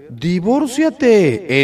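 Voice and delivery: drawn-out sarcastic suggestion; mocking advice. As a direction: dangle it like a bargain. Mocking the easy answer.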